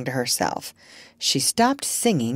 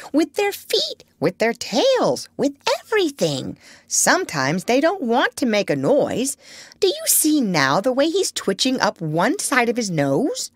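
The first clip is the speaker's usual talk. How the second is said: high-pitched parrot lecture; high, emphatic parrot voice; 6 semitones higher than usual. A narrator's reading. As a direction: explain it up high like a know-all bird. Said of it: "said the parrot in a high voice".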